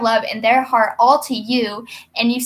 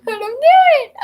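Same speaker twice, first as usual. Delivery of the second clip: excited squeal; 14 semitones higher, 6 dB louder than usual. Real, not acted. Laughing squeal.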